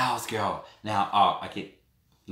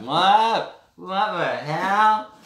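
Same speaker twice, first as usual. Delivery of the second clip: put-on loud, higher, slow drunken bewildered yell; drunk outburst; 9 semitones higher, 8 dB louder, much slower than usual. The real drunk her in the cells, a loud slow drunken yell. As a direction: stretch it out like a drunk who can't believe it. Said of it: "But in reality".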